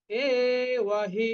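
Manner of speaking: এব হি with long drawn vowels. Shown as a demonstration. Teacher showing the long sound in এব হি. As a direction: stretch এব হি out slowly. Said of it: "এখানে এটা কিন্তু দীর্ঘ উচ্চারণ হবে".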